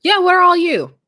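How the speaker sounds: excited. Real, not acted. Calling out to chat.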